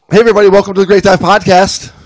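loud, high, fast show opener; big high-pitched host welcome; podcast intro. Acted. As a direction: launch the show at full volume.

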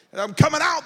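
mock shout. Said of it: "they said".

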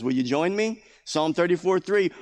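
ordinary delivery

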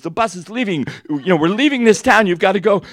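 high, loud, pressing call; retold hurry-up, pushed hard; urgent announcement. Man to missions team, urgently rounding them up.

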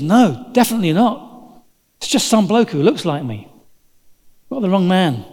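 acted protest of mistaken identity; insistent denial. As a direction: deny it firmly, as if wrongly accused. Peter's acted denial to accusers.

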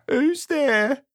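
high, squeaky little call; small sad squeak of a question. A narrator's reading. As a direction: squeak it out sadly, pitched well up. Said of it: "a sad, squeaky sort of voice".

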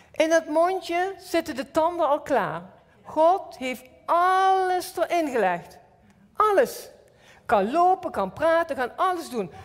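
preaching fervor. Acted. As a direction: proclaim it with rising fire. Preaching climax.

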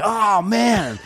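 high, drawn-out comic wail; Chris Farley-style outburst. Retold or imitated. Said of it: "like a Farley thing or something".

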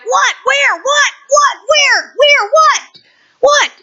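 high, loud, urgent what and where; searching wildly and failing; frantic what where. Pretend. Preacher acting a panicked binocular user, high frantic calls.

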